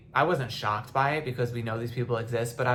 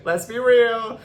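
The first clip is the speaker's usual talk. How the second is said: teary; 10 semitones higher, 9 dB louder, slower than usual. Real, not acted. Crying while speaking.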